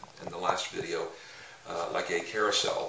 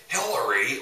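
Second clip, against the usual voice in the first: a higher, louder mock exclamation of Hillary; exclaimed name; 5 semitones higher, 7 dB louder than usual. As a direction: blurt Hillary out like it's in giant capital letters. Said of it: "like all gigantic font letters with an exclamation point".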